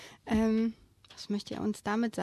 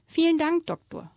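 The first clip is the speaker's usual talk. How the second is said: higher, louder grateful call, dropping off at the end; calling out thanks; 8 semitones higher, 3 dB louder than usual. Acted. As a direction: call a happy thank-you. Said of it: "rief es".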